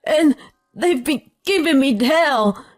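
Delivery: high-pitched, frantic pleading; urgent shout. A narrator's reading. Husband begs his wife to rescue him from her family, urgent. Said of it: "he urgently shouted".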